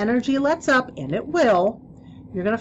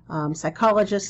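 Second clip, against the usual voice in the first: ordinary talk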